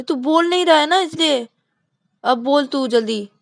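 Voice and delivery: high, loud snapping back; impatient retort. Acted. Shruti snapping at Vikas to speak quickly, high and loud. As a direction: hurry him up.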